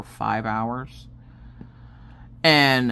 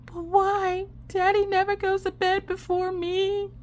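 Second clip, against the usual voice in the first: put-on much higher, slow child's voice; worried little girl. Daughter asking mom about dad, high worried child voice. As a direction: ask like a worried small child.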